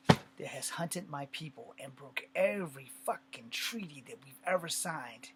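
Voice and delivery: loud, slow, emphatic anger; angry indictment. Acted. Native soldier accusing the government of broken treaties, angry. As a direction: hammer out each grievance, loud and drawn out.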